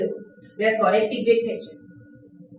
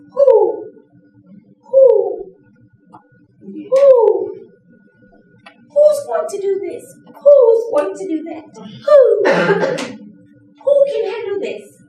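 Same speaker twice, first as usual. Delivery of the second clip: high, loud hoots of who; hooting owl; 9 semitones higher, 6 dB louder, much slower than usual. Acted. Trainer playing the cautious owl, high hooting. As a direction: hoot like a questioning owl. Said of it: "That's the owl".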